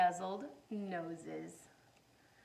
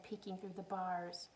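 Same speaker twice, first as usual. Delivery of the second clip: ordinary delivery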